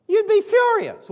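high, loud emphatic line; preacher drives home the insult, loud emphatic line; mock furious. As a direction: belt it out with indignation. Preacher stresses the anger.

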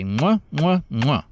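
loud smacking air kisses; kiss sounds. Real, not acted. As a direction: blow three big kisses. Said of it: "Three kisses".

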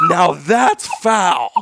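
high, slow, drawn-out jeer; taunting yell. Retold or imitated. Yelling at the whole family to mock the foul call, high, slow jeer. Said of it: "Yell it for your whole family".

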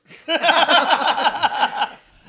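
long high loud cackling laugh. Real, not acted. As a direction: laugh hard and high, then let it fall. Laughing at baggage handler's answer.

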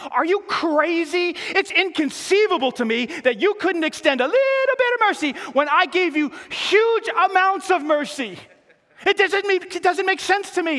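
outraged master confronting servant; incredulous shout. Acted. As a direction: explode at the ungrateful servant.

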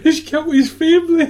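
high and loud, laughing through it; laughing mock report. Mother reporting the killer to police, high laughing mock voice. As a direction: blurt it out cracking up.

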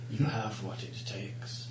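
slow hushed spirit whisper; eerie whisper. Acted. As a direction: whisper it slowly, like a tempting spirit. GM voices a mysterious entity.

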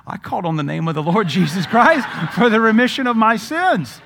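put-on high, loud old man's proclamation; imitated triumphant testimony, voice cracking into laughter; fervent declaration. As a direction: shout it out with hammed-up zeal.

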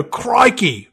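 high, put-on Australian exclamation; mock Australian accent. Shown as a demonstration. Podcaster attempting a bad British accent, landing on high Australian. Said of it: "Wait, that's Australian".

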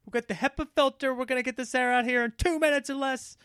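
high, pushy company spokesman voice; airline reassurance. Retold or imitated. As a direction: sell the reassurance high and insistent. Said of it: "the airlines say".

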